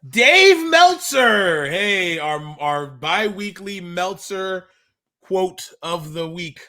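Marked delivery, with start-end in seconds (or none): none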